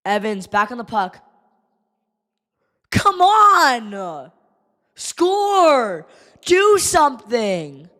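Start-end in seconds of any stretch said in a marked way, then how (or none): 2.9-7.9 shouting at the screen; fan yelling. Real, not acted. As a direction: urge the team on. Fan pleading at TV.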